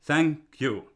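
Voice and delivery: ordinary delivery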